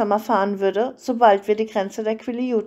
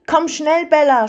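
higher, louder call over noise; urgent shout; 6 semitones higher, 6 dB louder than usual. A narrator's reading. Jacob calling Bella over the motorcycle's noise, urgent shout. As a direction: shout it over a roaring engine. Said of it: "schrie er über das Motorengeräusch hinweg".

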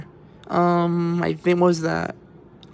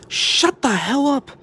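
annoyed yell; 8 semitones higher, slower than usual. Real, not acted. Yelling at noisy people downstairs.

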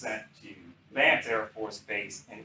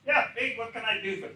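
high-pitched impression of a general; imitating a boss's high, thin pipe; 11 semitones higher, faster than usual. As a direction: lift it well up into a high register. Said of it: "He had this kind of a high-pitched voice".

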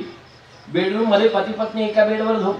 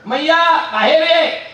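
loud high call outside the door; angry neighbour yells for Maiya; shouting for mother; 6 semitones higher, 4 dB louder, slower than usual. Acted. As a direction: bellow her name from the doorstep. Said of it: "काय ओरडायला काय झालं?".

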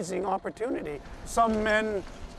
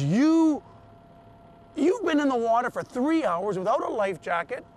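loud, high astonished 'you'; retold disbelief; 5 semitones higher, 5 dB louder than usual. Coast guard to rescued swimmer, incredulous outburst. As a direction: exclaim in stunned disbelief, voice jumping up.

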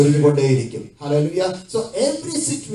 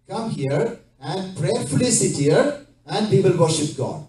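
normal talking voice

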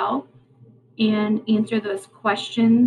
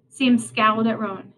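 usual speaking voice

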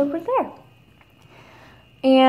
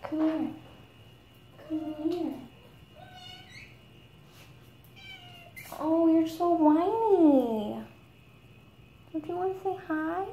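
coaxing pet talk; 4 semitones higher, 10 dB quieter than usual. Real, not acted. Coaxing her cat.

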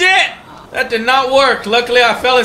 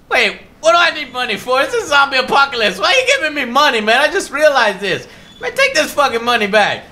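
mock indignation; 6 semitones higher than usual. Mock indignant at useless zombie-game money.

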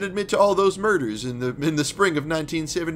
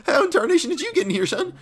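put-on southern accent; 10 semitones higher, 4 dB louder, faster than usual. Villain caught snooping, hammed-up southern accent. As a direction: ham up a southern yokel. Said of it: "The southern accent just drops".

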